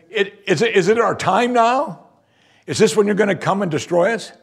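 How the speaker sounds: high-pitched, agitated acted questioning; imitated screaming. Demon asking Jesus if its time has come, mock screaming. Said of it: "he screams to him".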